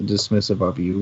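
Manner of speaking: ordinary delivery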